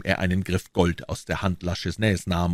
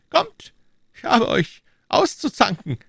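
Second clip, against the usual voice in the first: high, lofty royal voice; a king speaking down to his subjects; king's voice; 3 dB louder than usual. Acted. King to Treville, high lofty royal voice.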